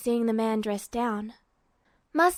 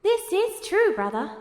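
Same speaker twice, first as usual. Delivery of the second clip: higher, lightly teasing knowing reply; 8 semitones higher than usual. A narrator's reading. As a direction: let him know you see through it. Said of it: "in a knowing tone".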